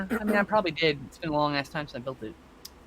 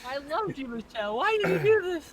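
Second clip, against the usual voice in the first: hammed-up betrayed lament, rising high; high-pitched pretend anguish; mock heartbreak; 10 semitones higher than usual. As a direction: wail it melodramatically at the one who betrayed you. Mock betrayed lament over Lego.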